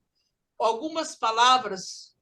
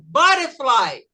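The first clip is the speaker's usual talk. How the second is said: "Butterfly" American style, t flapped to "bare-fly", loud; American accent; 7 dB louder, slower than usual. Shown as a demonstration. Teacher switches to American English, flapped t in "Butterfly". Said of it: "Agora vamos no inglês americano".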